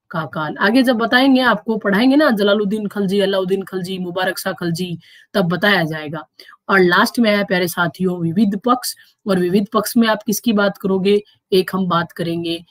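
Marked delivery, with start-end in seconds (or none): none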